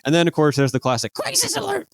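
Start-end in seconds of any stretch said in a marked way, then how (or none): 1.1-1.9 very high-pitched alarm call; Garrett's crisis alert. Retold or imitated. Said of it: "from Garrett".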